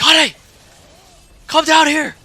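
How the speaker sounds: high, loud summons; imitated yelling. Girlfriend yells for boyfriend from downstairs, high imitated yell. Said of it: "she yells at me".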